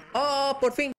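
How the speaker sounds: high, delighted exclamation; relieved excitement. Real, not acted. Player finally gets the item, relieved excitement. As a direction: let it burst out high.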